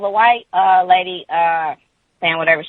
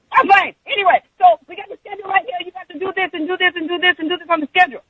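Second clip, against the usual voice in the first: high, fast screechy rant; mock frantic yelling, rattled off; imitated hollering; faster than usual. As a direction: mimic a coworker screaming orders. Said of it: "she's just hollering and screaming at me".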